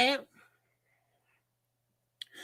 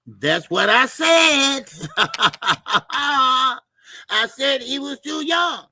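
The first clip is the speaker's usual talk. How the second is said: laughing vindication; 4 semitones higher, 4 dB louder, slower than usual. Real, not acted. Laughing back at chat correction.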